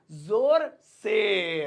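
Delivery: "जोर से" very slow, each word held long; drawn-out repetition. Shown as a demonstration. Teacher giving students the Hindi meaning, drawn out. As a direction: stretch both words of "जोर से".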